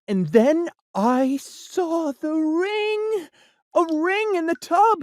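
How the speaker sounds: slowed, high-pitched amazement; surprised discovery. A narrator's reading. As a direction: gape at the ring in awe. Narrator spots the ring.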